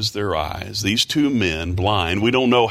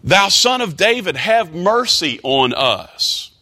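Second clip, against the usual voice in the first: crying out; 9 semitones higher than usual. Retold or imitated. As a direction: cry out for mercy from far behind. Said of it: "they say".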